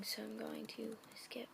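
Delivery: softly whispered aside to the audience. Real, not acted. Skipping part of a fanfic, whispered to viewers. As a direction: let the words drop to a whisper. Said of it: "whispered aside while reading".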